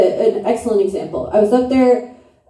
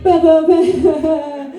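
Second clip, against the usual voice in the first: slow, higher catcall sounds; mock Italian uncle; 6 semitones higher, much slower than usual. Comic imitating her Italian uncles, slow high catcall. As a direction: ham up an Italian uncle's ogling noise.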